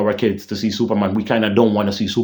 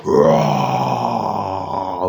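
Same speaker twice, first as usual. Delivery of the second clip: long, low, gruff roar; imitated roar; 7 semitones lower than usual. Fan replaying the Thing roaring at a bear, low roar. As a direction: let out a drawn-out low growling roar. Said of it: "where he roared at the bear".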